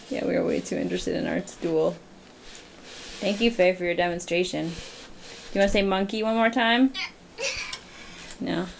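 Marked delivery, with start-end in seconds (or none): none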